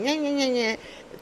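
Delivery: high whiny nyeh-nyeh, mocking a nagging wife; childish taunting nag sounds. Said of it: "the wife like".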